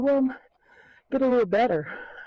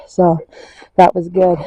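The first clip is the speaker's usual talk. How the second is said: usual speaking voice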